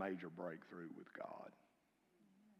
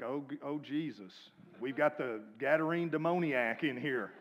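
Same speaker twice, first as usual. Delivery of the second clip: mock alarm; 4 semitones higher, 8 dB louder than usual. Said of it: "I was like".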